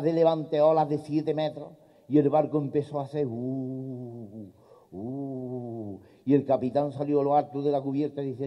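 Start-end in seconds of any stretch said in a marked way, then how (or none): 3.3-6.0 low soft far-off groaning of the ship; boat creaking. Acted. The small boat groans in the storm, low and soft. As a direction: moan like a boat rocking in waves.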